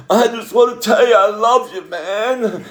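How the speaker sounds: high, loud drunken slur imitated. Imitating drunk stepson on the phone, loud and high. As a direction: mimic a hammered young man gushing. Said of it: "when he was hammered".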